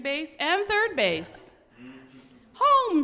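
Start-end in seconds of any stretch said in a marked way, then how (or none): 2.5-3.0 a high, loud shout of home. Acted. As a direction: bellow it across the field. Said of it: "shouted the boys".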